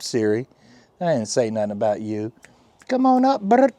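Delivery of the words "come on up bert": high, loud pet-greeting voice; baby talk to pet. Acted. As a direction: coo up at the cat like baby talk. Calls the cat Bert.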